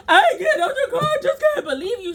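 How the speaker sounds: high, whiny crying impression; mock crying boyfriend. Mocking her crying boyfriend refusing to leave. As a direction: mimic him blubbering through laughs.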